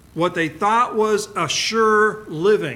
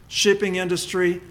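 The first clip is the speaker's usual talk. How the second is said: normal talking voice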